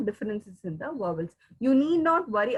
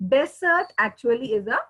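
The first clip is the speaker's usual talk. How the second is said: ordinary talk, nothing marked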